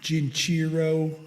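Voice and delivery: put-on slow, drawn-out "Jinchiro" in a thick New York accent; imitating a New York accent; mock New York accent. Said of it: "That's how he said".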